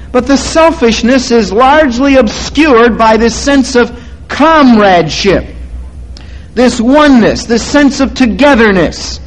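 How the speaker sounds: sermon building to a peak; preaching. Acted. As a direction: drive the point home.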